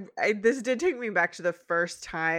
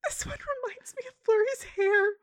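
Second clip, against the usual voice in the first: much higher voiced parent; much higher voice, gushing over art; high sentimental voice. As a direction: coo like a soppy parent. Shining Armor/Cadance voiced.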